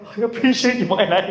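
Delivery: hammed-up weepy thanks; mock tearful. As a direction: play at being moved to tears. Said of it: "Ako pa yung umiyak".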